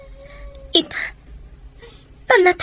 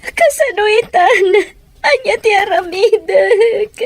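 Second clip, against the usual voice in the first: crying; 5 semitones higher, 4 dB louder than usual. Acted. Asks what to do, grieving.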